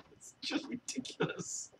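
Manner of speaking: breathy laughter through words; laughing words. Real, not acted. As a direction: gasp it out while laughing. Laughing as he speaks.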